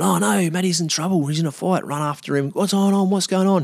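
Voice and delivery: high-pitched worried calling out; alarmed. Retold or imitated. Speaker recalls friend chasing into a fight, alarmed. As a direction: retell a panicked reaction, pitched well up.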